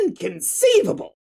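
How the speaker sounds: impression of the movie villain's catchphrase; Wallace Shawn impression. Shown as a demonstration. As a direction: yelp it like the inconceivable guy. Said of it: "Wallace Shawn!".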